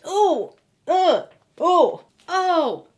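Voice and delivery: high wincing ooh and ugh; pained groans. Real, not acted. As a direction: wince at the snap. Reacting to ankle snap.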